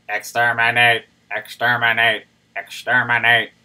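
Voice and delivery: loud, processed Dalek robot voice; robot voice effect. Shown as a demonstration. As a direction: electronic robot filter on the voice. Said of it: "I'll do the robot voice".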